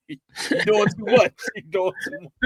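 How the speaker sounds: laughing high, playfully protesting; laughing at the church ladies' fuss. Real, not acted. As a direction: push back through laughter.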